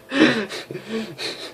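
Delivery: high comic villain cackle; evil cackle. Acted. Major gloating after a mock threat, cackle.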